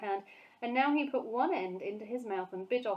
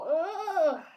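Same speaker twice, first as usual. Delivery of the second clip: spluttering ugh with a laugh; disgusted ugh; 9 semitones higher, 8 dB louder than usual. A narrator's reading. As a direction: spit out a sharp ugh. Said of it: "she spluttered".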